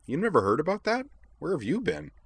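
high-pitched amazement; incredulous. A narrator's reading. Azalin looks curiously at Utena, incredulous. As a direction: question them in disbelief.

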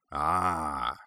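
lightly gruff, knowing "ah"; a dwarf smith catching on; understated gruff acknowledgement. A narrator's reading. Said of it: "said the dwarf in a gruff voice".

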